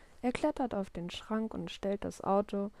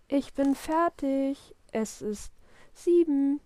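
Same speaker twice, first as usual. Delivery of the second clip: put-on loud, slow, high child's call; 5 semitones higher, 6 dB louder, slower than usual. A narrator's reading. Willi calls Papa once more at seven, calling out. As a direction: stretch each word to carry. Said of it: "Noch einmal ruft Willi".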